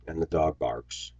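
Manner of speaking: usual speaking voice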